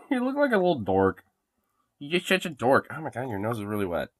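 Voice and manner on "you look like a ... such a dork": high teasing coo; pet talk. Real, not acted. Owner teasing her dog, higher cooing voice. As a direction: tease the dog fondly in a higher voice.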